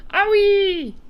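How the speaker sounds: very high, loud yelp; startled yell. A narrator's reading. BFG reacting to Sophie in his ear, yelled. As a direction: giant yelling in alarm. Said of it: "yelled the B F G".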